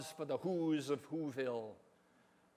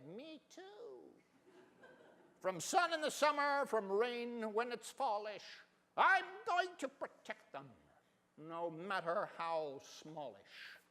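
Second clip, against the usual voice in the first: put-on much higher, small childlike voice; baby kangaroo; 4 semitones higher, 5 dB quieter than usual. Young kangaroo in the pouch joining in, small and high. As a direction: chirp it like a tiny joey. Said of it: "young kangaroo in her pouch said".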